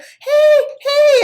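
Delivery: high, loud calling out "Hey, hey"; imitated yell. Stranger yelling from her car, a high loud call. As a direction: shout across the parking lot. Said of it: "this lady yell at me".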